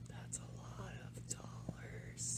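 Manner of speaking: hushed, slow whisper. Real, not acted. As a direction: breathe it out, barely voiced. Whispered reply to price.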